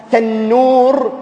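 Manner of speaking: "تنور" stretched slowly, the noon lingering; "تنور" said slowly with a long, resting noon; drawn-out noon. Shown as a demonstration. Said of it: "انظر النون كيف قعرت التنور".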